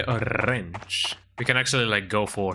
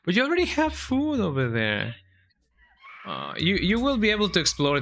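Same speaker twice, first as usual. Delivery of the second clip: much higher, sing-song scolding of the cat; pet talk; 8 semitones higher than usual. Real, not acted. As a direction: gently tease the cat up high. Talking to the hungry cat.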